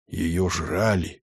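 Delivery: hushed, slow and low; softly whispered, sinking in pitch; whispered horror. A narrator's reading. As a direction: drag it out under your breath. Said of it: "прошептал Ганс".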